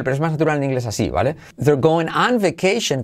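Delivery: usual speaking voice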